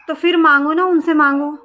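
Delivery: rising angry retort; angry demand. Real, not acted. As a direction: hurl it at her: go ask them.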